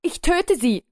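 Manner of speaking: viel höher, herausgeschrien; in Panik drohen; verzweifelte Drohung. A narrator's reading. Bewaffneter Mann droht. (hoch herausgeschriene Drohung)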